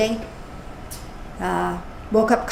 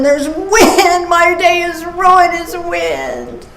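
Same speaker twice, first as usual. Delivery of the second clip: mock-grumpy whine, louder and higher; cranky complaining; 6 semitones higher, 4 dB louder, much slower than usual. Speaker acting out her cranky self about wind, mock grumble. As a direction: ham up a ruined-day gripe. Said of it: "Rather than".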